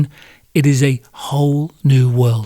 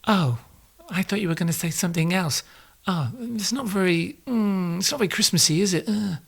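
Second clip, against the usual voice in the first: higher, softer wife voice, faintly disappointed; 5 semitones higher, 8 dB quieter than usual. Acted. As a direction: play the wife, soft and high, a little let down. Wife voiced in couple sketch.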